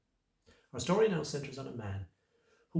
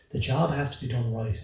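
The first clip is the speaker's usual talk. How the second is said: out of breath; 7 dB louder than usual. Acted. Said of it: "I say, gasping for breath".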